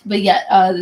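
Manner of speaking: ordinary talk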